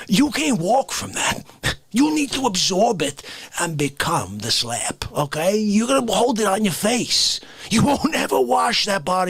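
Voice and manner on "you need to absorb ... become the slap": ordinary delivery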